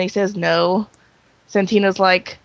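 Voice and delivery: normal talking voice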